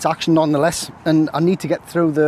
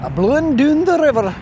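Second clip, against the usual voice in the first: terrible mock Scottish accent, pitched up high; bad Scottish accent; 11 semitones higher than usual. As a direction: ham up a Scottish brogue for the breeze. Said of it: "Terrible Scottish accents".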